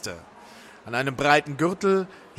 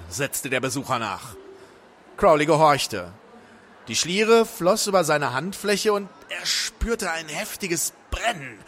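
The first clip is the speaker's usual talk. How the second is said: ordinary delivery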